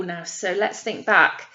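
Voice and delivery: ordinary talk, nothing marked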